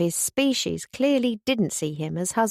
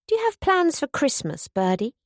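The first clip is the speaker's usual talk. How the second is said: bright, eager question jumping higher at the start; 6 semitones higher than usual. A narrator's reading. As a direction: ask it eagerly, leaning in. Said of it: "Topsy asked eagerly".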